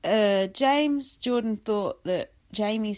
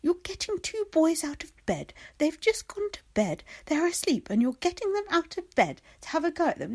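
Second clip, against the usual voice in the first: high, scolding mum voice, lightly done; imitating Kim's scolding; 8 semitones higher than usual. Kim scolding James for waking the boys, high and reproachful. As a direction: tut at him like a protective mother. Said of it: "And Kim's like".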